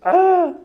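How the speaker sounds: high, very loud yelped "aah"; sudden agonised scream. Acted. Repeating the midrash scream for laughs, loud agonised cry. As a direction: let out a piercing mock scream.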